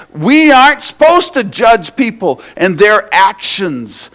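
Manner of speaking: loud, high, impassioned preaching. Real, not acted. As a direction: pour your heart into the sermon, high and loud. Preaching at full force.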